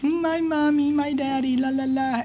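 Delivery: high singsong mock whine; mock her own old whining at the podium. Mocking her past whining at the podium, high singsong. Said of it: "whining about my feelings".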